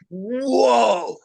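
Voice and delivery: a high startled whoa; retold surprise. Reacting with friends to a strange call in the woods, startled. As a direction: gasp it out, caught off guard.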